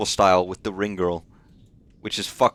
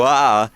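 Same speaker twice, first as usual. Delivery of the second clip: mock yelp; 7 dB louder than usual. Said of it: "and then you go".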